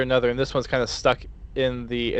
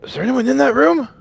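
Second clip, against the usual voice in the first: high, loud suspicious guard; 13 semitones higher, 6 dB louder than usual. Acted. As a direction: call out high into the dark room. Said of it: "this one will kinda look ... and say".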